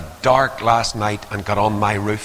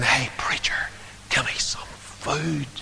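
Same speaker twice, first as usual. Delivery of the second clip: pleading whisper; 3 semitones higher, 8 dB quieter, slower than usual. Acted. Voicing a hungry backslider.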